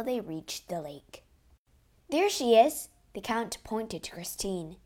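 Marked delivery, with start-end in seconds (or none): 2.1-2.9 high, loud cry of discovery; excited call. A narrator's reading. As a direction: call out the sighting. Count spots Christine on the lake.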